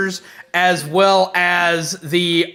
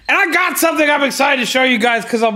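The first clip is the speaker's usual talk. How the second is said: excited; 7 semitones higher, faster than usual. Real, not acted. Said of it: "I'm very excited about it".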